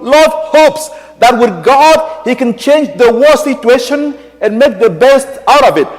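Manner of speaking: high, slow, ringing sermon voice; fervent preaching. Acted. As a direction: preach it out to the whole church. Preaching at full force.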